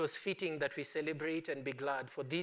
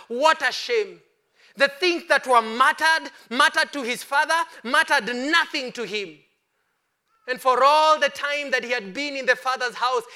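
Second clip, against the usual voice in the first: loud, high, ringing preacher's cry; full-force preaching; 7 semitones higher, 13 dB louder than usual. Acted. Preacher to the congregation, booming full-force sermon. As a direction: preach it out to the whole church.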